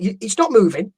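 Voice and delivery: ordinary talk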